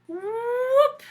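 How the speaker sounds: high loud whoop of falling. Acted. Wolf falling down the chimney, high loud whoop. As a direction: yelp a high whoop as you drop. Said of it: "pretty good onomatopoeias".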